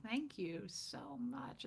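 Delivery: slow, whispery thank-you; hushed thanks. Real, not acted. Streamer answering a teasing look-alike remark, hushed thanks. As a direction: draw it out softly, barely voiced. Sarcastic thanks for groupie comparison.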